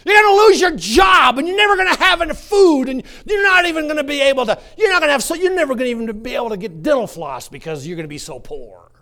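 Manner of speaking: high, loud shouted devil taunting; devil's threats. Acted. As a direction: bellow the devil's scare tactics at the crowd. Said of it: "He comes with fear and says".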